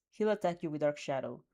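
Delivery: plain reading voice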